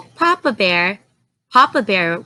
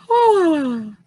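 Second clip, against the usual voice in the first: a long, higher storybook yawn; sleepy girl yawning; big yawn; 6 semitones higher than usual. A narrator's reading. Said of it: "She yawned".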